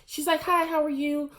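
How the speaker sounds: mock sweet high greeting; sugary greeting. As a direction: play it fake-friendly and high. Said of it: "she was like".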